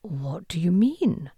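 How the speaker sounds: slow breathless whisper after a gasp; hushed and shaken; shocked whisper. A narrator's reading. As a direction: barely voice it, stunned. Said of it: "sucked in a deep breath".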